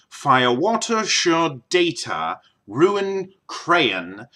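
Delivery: slow, neutral English announcer accent; BBC English accent. Shown as a demonstration. Vlogger reading the word list as the BBC, neutral English accent. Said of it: "neutral accent for England is going to be".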